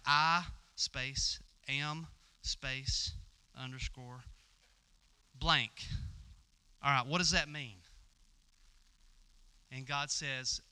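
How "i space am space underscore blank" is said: word by word, with long gaps; slow dictation. Acted. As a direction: spell it out slowly as if noting it down. Moses dictating a note to himself.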